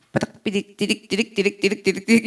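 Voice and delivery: soft, low computing beeps; imitated machine beeps. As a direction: rattle off quiet data-processing noises. (낮고 작게 기계 돌아가는 소리 흉내)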